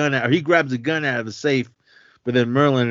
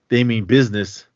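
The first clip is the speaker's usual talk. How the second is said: ordinary talk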